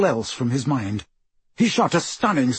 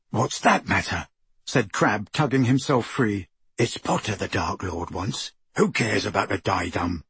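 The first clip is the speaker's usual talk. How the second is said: gruff thug voice; 5 semitones lower than usual. A narrator's reading. Crabbe argues gruffly.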